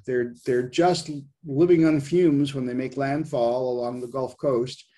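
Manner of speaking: ordinary delivery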